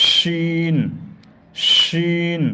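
শিন with a whistling snake-like hiss; long sibilant shin, like a snake hissing. Shown as a demonstration. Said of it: "মুখে সিটি আসবে, যেমন সাপ".

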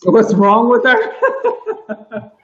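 slow, high mimicry breaking into laughter; amused, stretched-out parroting through laughs; laughing echo. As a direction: repeat it drawn out, cracking up. Echoes his quote while cracking up.

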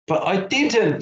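high, mildly tearful protest; child's voice on the edge of tears; starting to cry. A narrator's reading. Billy denying he left Rabbit, near tears. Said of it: "starting to cry".

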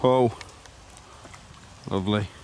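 ordinary talk, nothing marked

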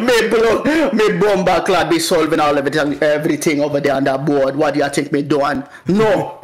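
thick Jamaican patois for laughs; Jamaican accent. Acted. Student answering the teacher at the board, Jamaican accent. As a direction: lay on the Jamaican accent, full of attitude. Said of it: "really good Jamaican accent".

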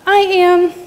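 a small sprite chiming its answer; tinkly bell voice. A narrator's reading. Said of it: "replied a tinkling voice".

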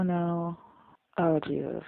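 usual speaking voice

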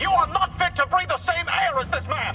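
furious shout. Acted. Car screaming at the rude fan, furious. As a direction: roar at him to get out.

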